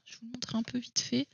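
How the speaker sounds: an unvoiced whisper, half to herself; whispered aside. Real, not acted. Showing the book to viewers, in a whispered aside. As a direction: whisper it quickly while fetching the book.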